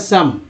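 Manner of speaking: सम with a short "uh" vowel; correct short uh. Shown as a demonstration. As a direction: say "some" as सम, rhyming with hum. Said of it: "क्या पढ़ते हैं".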